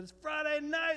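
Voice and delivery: calling out. Retold or imitated. Singer to a packed bar, high rousing call.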